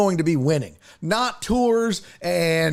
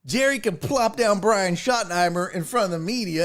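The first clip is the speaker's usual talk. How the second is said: ordinary talk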